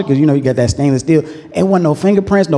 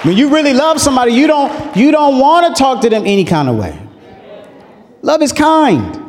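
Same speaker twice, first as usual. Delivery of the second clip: preaching climax; 9 semitones higher than usual. Acted.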